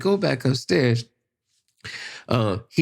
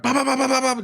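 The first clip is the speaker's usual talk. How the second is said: rapid high "bah" shots mimicking gunfire; imitated gunfire; 12 semitones higher, much faster than usual. Retelling his father firing back at the robbers, high mock gunshots. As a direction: spit out gunshots like a toy gun. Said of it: "just pulling the trigger".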